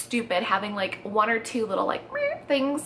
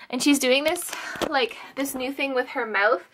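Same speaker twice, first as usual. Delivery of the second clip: ordinary talk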